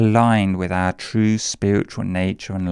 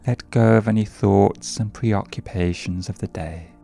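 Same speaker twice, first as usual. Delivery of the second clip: guided meditation. Acted. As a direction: ease them gently into the breath. Leads a meditation.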